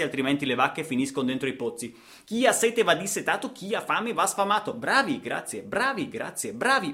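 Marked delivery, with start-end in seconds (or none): 2.3-6.9 high ironic sing-song; mock the do-gooders; high sarcastic applause voice; mocking praise.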